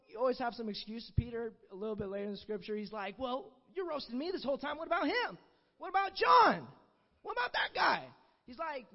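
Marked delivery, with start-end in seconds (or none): 3.2-8.1 indignant protest. Acted. Peter protesting to Jesus.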